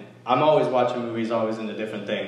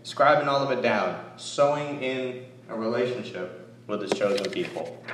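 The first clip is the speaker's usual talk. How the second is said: usual speaking voice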